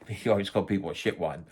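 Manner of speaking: ordinary talk, nothing marked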